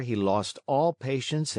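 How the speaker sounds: plain reading voice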